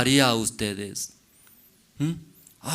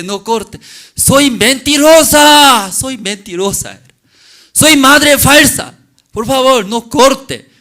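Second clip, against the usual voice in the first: ruego agudo y fuerte; súplica gritada; 12 semitones higher, 13 dB louder than usual. Acted. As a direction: clama desesperada para salvar al bebé. Madre suplica al rey que no corte. (la madre suplica gritando al rey)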